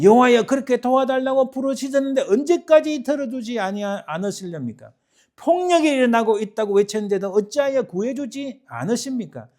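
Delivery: loud, high cry to God; impassioned, accusing outcry; shouted protest. Acted. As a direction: shout the questions, building to a peak. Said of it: "하고 막 고함을 치는 거예요".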